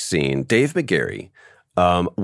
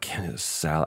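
aside; 4 semitones lower, 10 dB quieter, faster than usual. Retold or imitated. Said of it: "she's just kind of over here is like".

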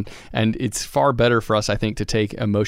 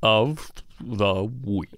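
put-on slow slurred drunk drawl; 4 dB quieter, much slower than usual. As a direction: slur it like you've had a few. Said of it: "was that, like, a drunk voice?".

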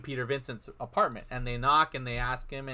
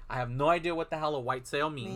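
ordinary talk

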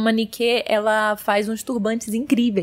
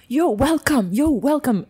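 welcoming; 6 semitones higher than usual. Retold or imitated. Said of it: "eles falam".

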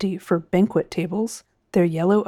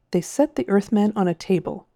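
ordinary delivery, nothing marked

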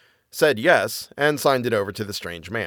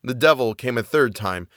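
usual speaking voice

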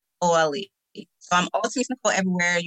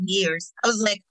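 usual speaking voice

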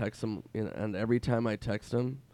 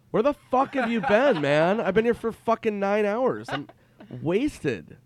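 put-on much higher and louder drunken outburst; mock angry yell; 10 semitones higher, 7 dB louder than usual. Drunk friend yelling at Norm for showing up late. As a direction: let him have it, exasperated.

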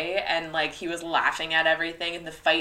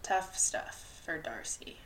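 slow hushed whisper; 3 semitones lower, 11 dB quieter, slower than usual. Real, not acted. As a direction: whisper it back, slowly. Replying in a whisper.

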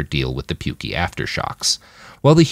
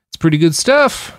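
brightly helpful offer, pitched up; 11 semitones higher than usual. A narrator's reading. Roland offering Manny a line, helpful. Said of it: "said in a helpful tone".

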